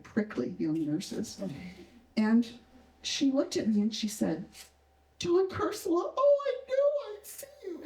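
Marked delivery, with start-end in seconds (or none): 5.2-7.8 high squeal tipping into tears; very high-pitched, emotional outburst; tearful, overcome. Acted. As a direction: imitate an overwhelmed young nurse about to cry. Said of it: "And she started to cry".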